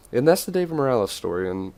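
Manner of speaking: ordinary delivery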